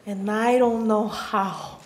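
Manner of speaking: usual speaking voice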